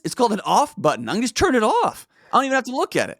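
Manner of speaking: high-pitched sarcastic wonder; mock amazement. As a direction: marvel in a high, facetious voice. Feigns amazement at off button.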